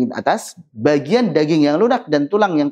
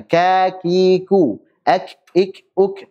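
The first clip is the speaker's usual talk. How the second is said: slow drill; 4 semitones higher, much slower than usual. Shown as a demonstration. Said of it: "Kita latih misalkan".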